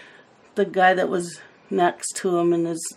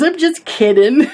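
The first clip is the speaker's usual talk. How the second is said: laughing retelling; 8 semitones higher, 7 dB louder than usual. Retold or imitated. Laughing retelling of sister's joke.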